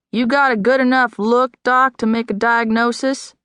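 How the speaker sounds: sarcastic bartender teasing the staring doctor. Acted. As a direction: needle the doctor with thick sarcasm. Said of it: "Margie's tone had been thick with sarcasm".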